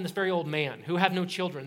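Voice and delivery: normal talking voice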